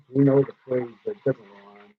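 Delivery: normal talking voice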